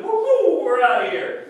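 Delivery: high, loud whooping cry; excited whoop. Real, not acted. Preacher whooping as the sail catches wind, loud and high. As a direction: whoop like the wind just caught your sail.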